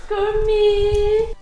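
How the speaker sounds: high, drawn-out, loud call; loud singsong holler. Real, not acted. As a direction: sing out the name, high and long. Calling out to Kermit.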